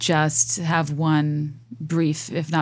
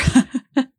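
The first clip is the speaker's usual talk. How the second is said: laugh; 8 semitones higher, 6 dB louder than usual. Real, not acted. After joke.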